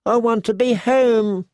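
high-voiced insistent little boy; demanding child. A narrator's reading. Rickon insisting amid the ruins, high demanding voice. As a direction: press the demand in a high plaintive voice. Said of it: "Rickon demanded".

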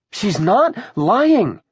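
high, loud, slow rebuttal; forceful defense. Acted. Willa defending Pammy to her mother, forceful. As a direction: stretch the denial and push it hard.

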